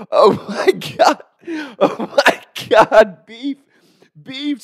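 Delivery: high, alarmed cries; horrified. Retold or imitated. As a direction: react in shock to a hurt friend. Retold horror at finding bloody friend.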